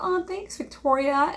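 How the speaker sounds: high, put-on sweet mom; mock-grateful mother voice; mom thanking her child. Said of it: "And the mom's like".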